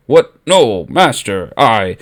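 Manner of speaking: flustered stammering, slow; stutter. Acted. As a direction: caught out, tripping over words. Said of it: "Vader started to stutter".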